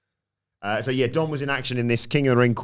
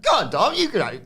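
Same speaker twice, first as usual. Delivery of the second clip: very high, louder needling; mock teasing push; 12 semitones higher, 4 dB louder, faster than usual. [name] goading Dom to congratulate Roxanne, very high tease. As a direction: goad him in a high voice.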